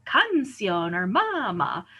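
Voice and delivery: "canción or mama" wrongly stressed on CAN and the first MA; drawn-out first syllables in "canción or mama"; stress moved to first syllable. Shown as a demonstration. Teacher shows what the rule would give, "canción or mama" stressed on the first syllables. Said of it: "we should say actually".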